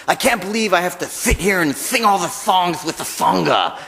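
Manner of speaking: lisping cartoon cat impression; lightly voiced Sylvester lisp.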